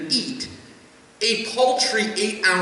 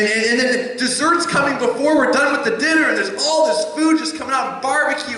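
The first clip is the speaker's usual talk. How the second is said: ordinary talk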